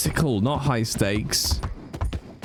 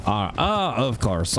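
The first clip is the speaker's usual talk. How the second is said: ordinary delivery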